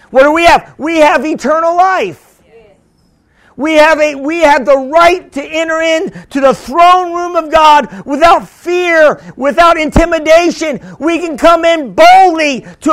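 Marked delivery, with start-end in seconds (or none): none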